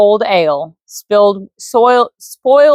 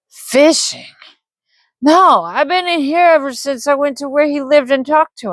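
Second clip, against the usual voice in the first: put-on drunk man denying; 8 semitones higher than usual. Drunk man denies murder.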